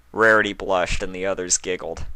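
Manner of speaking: ordinary delivery, nothing marked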